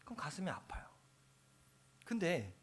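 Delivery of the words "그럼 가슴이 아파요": whisper. Real, not acted. Grief for departed members.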